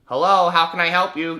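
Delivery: high, loud mock receptionist; Canadian phone helper. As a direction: put on a chirpy phone greeting. Imitates second Canadian taking over.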